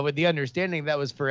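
ordinary talk, nothing marked

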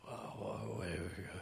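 hushed wait wait wait; alarmed whisper. Real, not acted. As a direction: stop them in a whisper. Alarmed interruption.

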